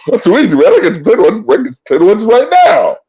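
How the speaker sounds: giggling high through the words; can't stop laughing while saying it. Real, not acted. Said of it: "laughing through the line".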